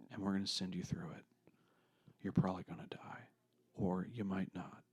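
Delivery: dramatic whisper. Shown as a demonstration. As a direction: whisper it like a secret. Dramatic hushed mission offer.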